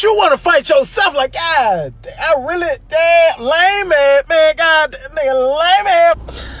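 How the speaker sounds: rising frustration spilling over; worked-up rant. Real, not acted. Ranting at oneself, climbing pitch.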